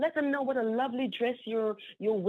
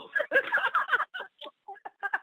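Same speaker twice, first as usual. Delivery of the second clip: a high burst of giddy laughter; high delighted laugh. Real, not acted. Celebrating at midnight.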